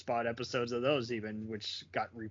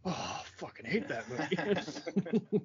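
slow, higher-pitched mock loathing; mock disgust; 4 semitones higher, slower than usual. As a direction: drag it out like a hater, half laughing. Acting out a hater's reaction.

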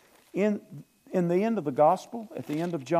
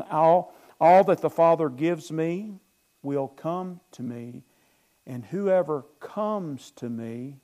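slow and louder at the start, slowing down; scripture reading; 5 dB louder, slower than usual. Acted. Pastor reading verse thirty-seven to the church, slow and weighty. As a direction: lean into the words like reading from the pulpit.

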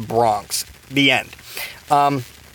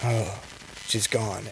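lower, much softer, detached, like it barely matters; aloof; 6 semitones lower, 12 dB quieter, slower than usual. Retold or imitated. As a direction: shrug off the loss quietly. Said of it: "one that's just very aloof".

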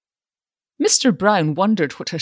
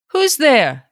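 high, loud challenge; sharp demand; 9 semitones higher, 6 dB louder than usual. A narrator's reading. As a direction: bark into the dark garage. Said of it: "he demanded sharply".

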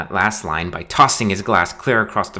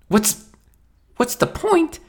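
much higher, disbelieving; best man echoing the groom's question, incredulous; slower than usual. Acted. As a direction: throw the question back in disbelief.

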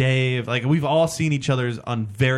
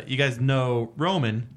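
ordinary talk, nothing marked

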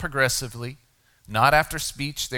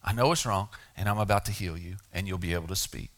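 tender; 5 semitones lower, 5 dB quieter than usual. Acted. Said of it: "Jesus explained to him".

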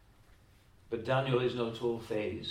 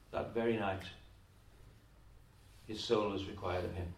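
hushed solemn; 6 semitones lower, 12 dB quieter than usual. Acted. Retold warning, dropped hushed.

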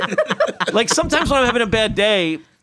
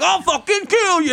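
higher-pitched mock death threat; retold threat; 8 semitones higher than usual. Stranger answers his apology, mock furious threat. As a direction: imitate someone blowing up. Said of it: "then they'd be like".

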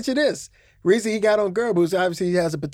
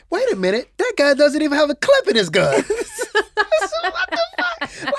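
rising higher and louder, breaking into laughter; incredulous laughing rant; 13 semitones higher, 3 dB louder than usual. Real, not acted. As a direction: pitch climbing as the disbelief grows. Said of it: "I wrote a note".

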